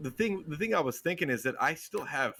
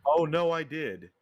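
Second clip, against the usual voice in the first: sarcastic; 4 semitones higher, slower than usual. Real, not acted. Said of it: "you're being sarcastic".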